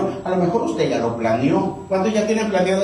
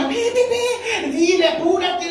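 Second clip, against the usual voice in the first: frantic Laura; 13 semitones higher, slower than usual. Retold or imitated. Late woman urging taxi driver.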